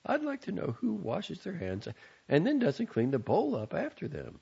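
high, grim, disapproving mother; mother grumbling about the unwashed basin. A narrator's reading. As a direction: complain pointedly to the whole household. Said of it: "she said grimly".

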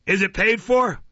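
loud, high pointed question; accusing magistrate. Acted. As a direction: challenge him from the bench. Magistrate questions witness suspiciously.

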